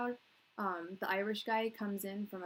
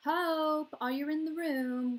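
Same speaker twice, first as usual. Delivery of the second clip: louder, higher summoning call; 6 semitones higher, 6 dB louder, slower than usual. Real, not acted. Host checking if a chat member is in the room, calling out. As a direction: call the name out to find them.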